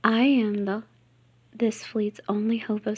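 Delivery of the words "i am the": ordinary delivery, nothing marked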